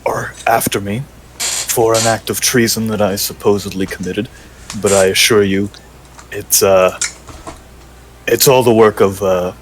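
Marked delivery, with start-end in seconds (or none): 0.0-4.3 stage whisper. Acted. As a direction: hush it so nobody overhears. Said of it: "I'm gonna try to say this as quietly as possible".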